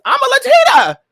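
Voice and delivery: high loud exclamation; mock indignant. Host defending her joke, high and loud. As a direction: protest it with a squeal.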